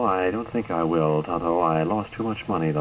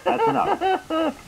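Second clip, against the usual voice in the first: slowed, giggling through the words; laughing; slower than usual. Real, not acted. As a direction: try to stop, cracking up. Host breaking up laughing.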